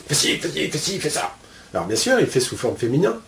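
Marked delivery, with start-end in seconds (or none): 0.1-1.3 a lightly voiced bossy butler giving orders. Butler giving the nanny orders, lightly mocked. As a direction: rattle off the orders like a mild boss. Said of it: "C'est lui qui donne les ordres".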